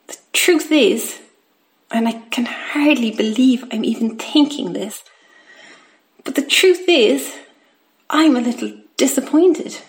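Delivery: plain reading voice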